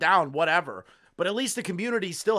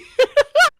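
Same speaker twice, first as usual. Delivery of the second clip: shrieking burst of laughter; loud high laugh; 13 dB louder than usual. Real, not acted. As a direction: let out a high cackle.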